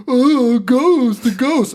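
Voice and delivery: put-on high, loud taunting kids; mocking boys. Boys taunting their sister, high loud taunt. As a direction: tease like bratty little brothers. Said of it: "little boys are like".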